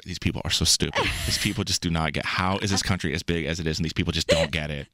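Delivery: snooty British voice. Retold or imitated. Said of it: "you hear these Americans".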